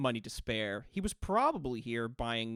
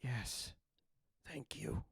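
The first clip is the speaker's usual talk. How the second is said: soft relieved ghost whisper; 9 dB quieter, much slower than usual. Acted. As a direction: thank them on a breath.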